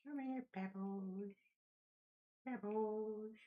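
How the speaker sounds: high, slow sing-song call to a pet; high coaxing pet-call. Pretend. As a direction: coax the little pet over in a high voice. Calling a pet, put-on voice.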